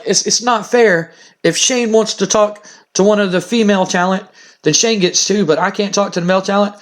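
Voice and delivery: a mild imitation of an aggrieved daughter; protesting daughter. Daughter protests father's double standard.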